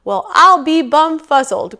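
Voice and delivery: loud, high, amazed big bear; amazed growling bear. A narrator's reading. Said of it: "growled the great big bear".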